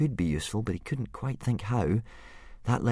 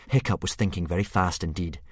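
plain reading voice

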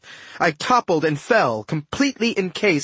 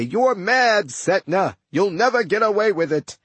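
higher-pitched mock protest; mocking the captive; 4 semitones higher than usual. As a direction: lightly parody the hero's predictable objection. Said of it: "This is the part where you say".

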